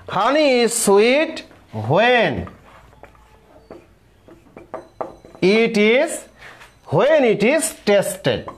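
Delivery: slow dictation. Shown as a demonstration. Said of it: "teacher dictating example sentence".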